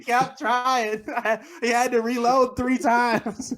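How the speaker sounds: high voice breaking into laughter; laughing. Real, not acted. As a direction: laugh through the words. Laughing at his own joke.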